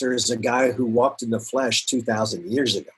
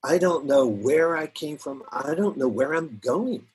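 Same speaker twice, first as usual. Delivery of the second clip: ordinary talk, nothing marked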